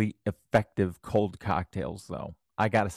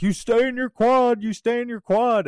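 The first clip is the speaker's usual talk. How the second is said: much higher, louder bossy command; mocking staff order; 12 semitones higher, 4 dB louder than usual. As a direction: parrot the nurses ordering him back. Nurses ordering doctor back.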